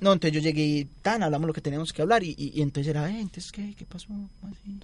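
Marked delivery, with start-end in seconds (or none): 3.1-4.8 imitando un susurro confundido; mock confused whisper. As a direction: casi sin voz, preguntando perdido. Retelling confused reaction in car. (susurro fingido de desconcierto)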